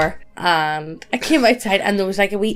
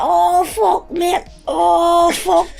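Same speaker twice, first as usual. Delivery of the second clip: put-on higher, pained and dazed, like a bloodied drunk; injured guy's tone; 6 semitones higher, much slower than usual. Bloodied man groaning to his mate outside the kebab shop, higher dazed voice. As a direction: imitate the battered fella outside the kebab shop. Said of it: "He uses the exact same tone of voice as this".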